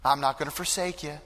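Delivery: tender soft comfort; gentle reassurance. Acted. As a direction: arm around a grieving father, speak softly. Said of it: "Jesus constantly reminds us".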